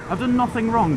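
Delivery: high, loud protest of innocence; excited protest. Real, not acted. Reacting to blue lights.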